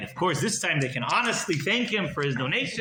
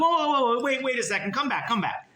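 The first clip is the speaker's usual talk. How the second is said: higher, urgent wait; calling back; 8 semitones higher than usual. Acted. Miser chasing rabbis down the stairs, calling higher. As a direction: hurry them back.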